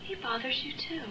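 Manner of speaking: soft, breathless whisper. Acted. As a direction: whisper it so nobody hears. Confiding doubts about West.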